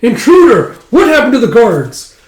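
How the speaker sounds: high, loud cry of alarm; acted panicked yell; alarmed shout. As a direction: call out for the guards in alarm. Calling out alarm at intruder.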